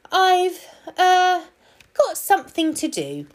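very high, halting hedgehog excuse; hesitant hedgehog. A narrator's reading. Hedgehog excusing himself from the plan, hesitant. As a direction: stall nervously in a high, loud voice.

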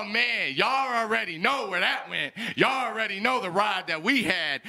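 ordinary delivery

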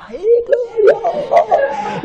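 very high, long-held jubilant shout; mock ecstatic cheer. Congregation erupting over twenty million naira, mock ecstatic. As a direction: burst into drawn-out, hammed-up praise.

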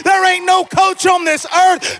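impassioned pulpit crescendo; fervent, mounting sermon cry; full-force preaching. Real, not acted. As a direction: whip the congregation up into praise.

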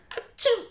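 tiny high-pitched sneeze; squeaked-out pretend achoo; little rodent's fake sneeze; mock sneeze. Imitating the rat's sneeze.

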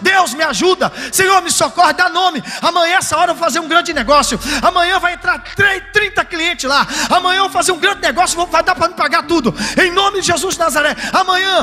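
a desperate believer begging God, loud and high; mock desperate prayer, hammed up; anxious believer's prayer. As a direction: plead like someone who can't sleep over money. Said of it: "esperando só você falar".

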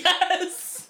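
high, laughing cry of yes. Real, not acted. Reliving meeting Kim, laughing and high. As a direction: burst out with delight.

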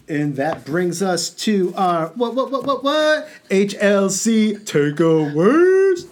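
high, slow hype voice; announcer voice. Pretend. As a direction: belt out the segment title. Show segment jingle voice.

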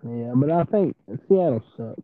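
muffled, bassy phone sound; muffled bass only. Real, not acted. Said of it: "You sound muffled right now".

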